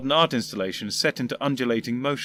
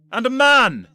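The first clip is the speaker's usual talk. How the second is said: much higher, loud alarmed yell; almost yelling; 12 semitones higher, 9 dB louder, slower than usual. Acted. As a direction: shout it in horror. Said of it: "almost yelling".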